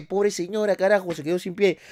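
normal talking voice

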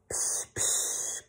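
soft whispered psh, psh. A narrator's reading. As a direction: breathe the sound back. Pig repeats the noise.

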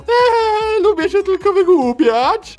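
very high squeaky hamster voice; chipmunk. Acted. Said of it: "Przestań gadać głosem chomika świra".

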